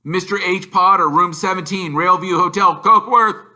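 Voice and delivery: loud, high, building announcement; reading the address. A narrator's reading. Narrator reading the green-ink letter, rising loud. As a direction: read the address out with mounting emphasis.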